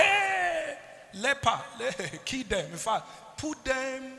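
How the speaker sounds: high, drawn-out cry of "hey", then hounding the outcast; villagers shunning a leper. Acted. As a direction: play an alarmed crowd driving someone out. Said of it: "Our people say".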